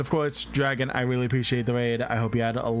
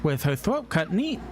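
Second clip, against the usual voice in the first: dramatic narration; 8 semitones higher than usual. Acted. Reads the game's dark intro.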